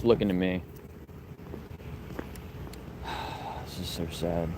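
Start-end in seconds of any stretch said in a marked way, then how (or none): 1.3-3.6 heavy sigh. Real, not acted. Sad scene, coroner present. 3.6-4.5 sad murmur. Real, not acted. Sad scene after death.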